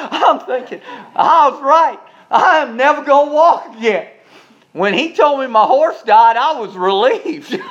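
high, loud voice bubbling with laughter; laughing. Real, not acted. Preacher confessing relief at the dead horse, laughing. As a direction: tell it high-pitched, on the edge of cracking up. Laughing through his own funny story.

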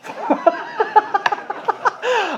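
long, high wheezing cackle; helpless high-pitched laughing fit. Real, not acted. Preacher tickled by his own childhood story, high laughter.

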